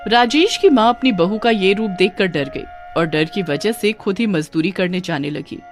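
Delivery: ordinary delivery, nothing marked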